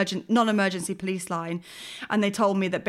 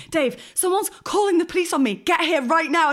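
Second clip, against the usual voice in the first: higher, urgent mock panic; phone your husband in a half-acted panic; retold panic; 9 semitones higher, 5 dB louder than usual. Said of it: "in an absolute panic".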